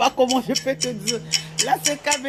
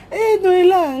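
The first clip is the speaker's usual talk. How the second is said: loud call; 7 semitones higher, 7 dB louder, slower than usual. Real, not acted. Calling out a viewer's name.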